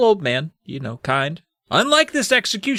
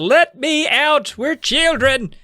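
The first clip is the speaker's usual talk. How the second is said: high, drawn-out begging; hammed-up children pleading to be freed; mock pleading; 3 dB louder, much slower than usual. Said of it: "They plead with him to let them out".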